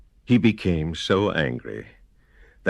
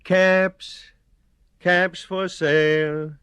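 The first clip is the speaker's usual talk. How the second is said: higher, slow sing-song sales call; street vendor calling; 11 semitones higher, slower than usual. Acted. Peddler walking to town, calling out caps for sale. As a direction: call out your wares down the street.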